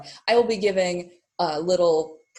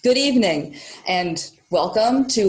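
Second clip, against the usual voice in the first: louder, higher stage welcome; emphatic greeting; 4 semitones higher, 7 dB louder than usual. Real, not acted. Host restarting the welcome to the audience, loud and emphatic. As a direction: open the show with a big, projected greeting.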